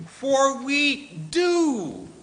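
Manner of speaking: "For we do" with "do" drawn out high and loud, slowly; stress on DO. Shown as a demonstration. Preacher to congregation, drawing out "do" emphatically. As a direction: lean hard on "do", slow and high. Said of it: "what he is literally saying is".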